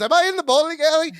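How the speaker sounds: high, quick, bewildered question; disoriented character. Retold or imitated. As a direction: play someone with no idea where they are. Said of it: "He doesn't even know where he is".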